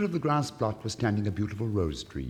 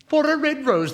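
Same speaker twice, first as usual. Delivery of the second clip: much higher, louder incredulous cry; 11 dB louder than usual. Acted. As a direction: shriek it in mock disbelief. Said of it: "they cried".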